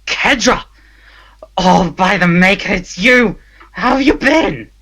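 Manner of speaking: loud, high and delighted to see a friend; excited greeting. Acted. Prisoner rushes to greet his friend.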